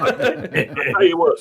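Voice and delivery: put-on British accent. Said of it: "bring up my old British accent".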